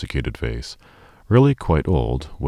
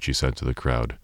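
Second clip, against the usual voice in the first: ordinary delivery, nothing marked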